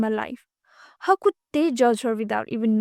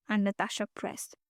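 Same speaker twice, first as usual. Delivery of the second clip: ordinary delivery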